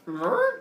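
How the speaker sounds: high questioning swan chirp; mock swan noise. Zeus as a swan replying to her, questioning honk. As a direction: make a little swan honk. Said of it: "Zeus the swan goes".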